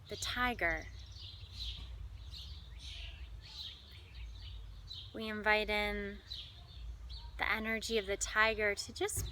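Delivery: measured delivery with long, spacious pauses; guided meditation. Acted. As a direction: lead listeners gently through imagery, pausing between phrases. Leads animal imagery meditation.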